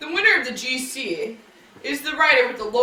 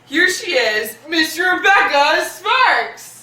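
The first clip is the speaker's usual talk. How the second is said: usual speaking voice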